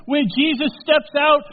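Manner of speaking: loud, high building preaching; rising preaching. Real, not acted. Preacher to congregation, building loud preaching. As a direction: build the fire toward the climax.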